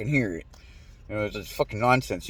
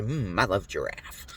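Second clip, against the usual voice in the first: softer, slow mock savor; 6 semitones lower, 8 dB quieter, slower than usual. Telling humans to feed AI disinformation, mock savoring. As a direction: purr it like a tasty bite.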